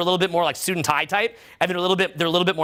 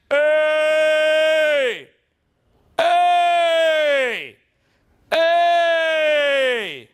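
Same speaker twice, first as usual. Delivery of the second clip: high, long sustained ringing hey; loud booming call; 7 semitones higher, 5 dB louder, much slower than usual. Shown as a demonstration. Coach demonstrating effortless volume to the room, booming. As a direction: boom it out with an open voice. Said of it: "see how loud that is?".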